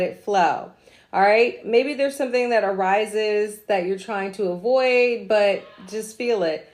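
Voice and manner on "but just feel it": ordinary talk, nothing marked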